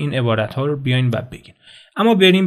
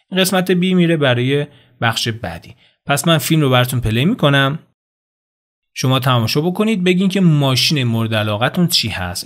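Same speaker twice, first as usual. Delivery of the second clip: ordinary delivery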